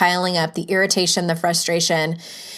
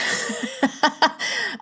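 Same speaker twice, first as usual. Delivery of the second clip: light, high laugh at herself; soft amused laugh; 7 semitones higher, 4 dB quieter than usual. Real, not acted. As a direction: giggle at the silly accent. Laughs at own accent.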